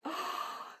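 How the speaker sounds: breathed, voiceless "oh"; touched gasp. Retold or imitated. Listener moved by old friends' goodnight calls, hushed and touched. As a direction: let out a hushed, melting aww-like reaction. Teller reacts, touched by sweetness.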